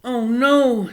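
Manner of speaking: put-on high, loud distressed boy voice; Bryce crying out. A narrator's reading. Boy Bryce panicking as sister struggles, high and loud. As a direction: cry out like a frightened boy. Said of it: "said Bryce".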